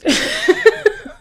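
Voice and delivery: a breathy, high stifled laugh. Real, not acted. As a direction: laugh it off under your breath. After joking about violence.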